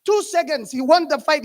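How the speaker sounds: ordinary talk, nothing marked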